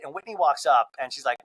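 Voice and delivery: ordinary delivery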